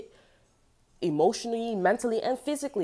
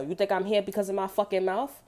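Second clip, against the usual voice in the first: ordinary delivery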